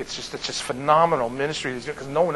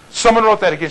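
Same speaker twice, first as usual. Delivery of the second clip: higher, louder retold indignation; mock fury, pitched up; imitated furious wife; 6 semitones higher, 5 dB louder, faster than usual. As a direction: mimic a wife's irritated outburst. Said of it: "Marie gets furious about it".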